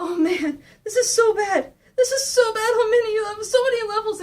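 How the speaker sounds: high, fretting lament; distressed Mikey. Retold or imitated. Mikey on his knees by his brother, high mock distress. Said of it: "He's going like".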